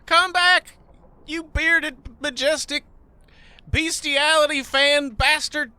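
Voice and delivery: high-pitched mock-desperate call. Player pleading with the runaway goose, calling out. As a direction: call after them in halting bursts.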